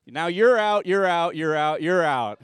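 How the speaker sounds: loud, playful you're out, rising at each end; gleeful call-out. Real, not acted. Pastor calling kids out of the game in loud playful glee. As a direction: catch them out with a loud teasing call.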